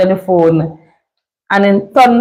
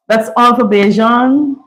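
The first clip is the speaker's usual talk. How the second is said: normal talking voice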